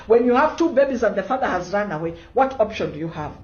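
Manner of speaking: ordinary talk, nothing marked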